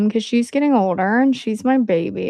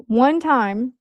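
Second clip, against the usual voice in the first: ordinary talk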